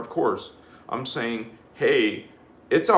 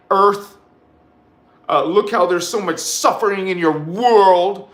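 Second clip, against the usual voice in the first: louder, higher scolding sermon; fiery preaching; 6 semitones higher, 4 dB louder than usual. Acted. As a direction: scold the listeners like a preacher in full force. Preaching at full force.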